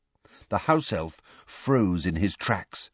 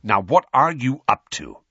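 stern demand; 5 semitones higher, 6 dB louder than usual. A narrator's reading. Sirius demands.

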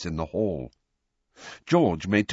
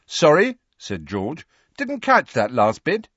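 mock challenge; 8 semitones higher, 6 dB louder than usual. A narrator's reading.

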